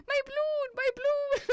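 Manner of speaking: high, loud mock wail of a fleeing child; panicked kid. Kid running from a German shepherd, hammed-up panic. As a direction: cry out like a little kid, hammed-up. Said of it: "a kid running with a balloon going".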